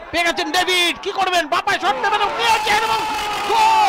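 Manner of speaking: high, loud shout building to goal; goal call. Real, not acted. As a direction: climb and explode on goal. Goal scored live.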